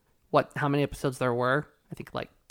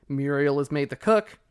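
ordinary talk

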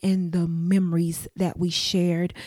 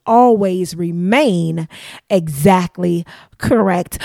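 upbeat sign-off; 8 dB louder, slower than usual. Real, not acted. Podcast sign-off catchphrase.

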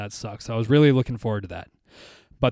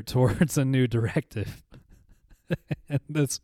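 ordinary talk, nothing marked

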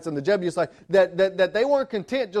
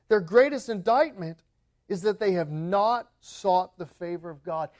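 ordinary talk

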